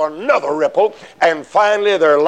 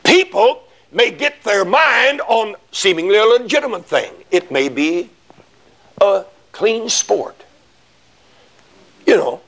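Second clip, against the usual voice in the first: normal talking voice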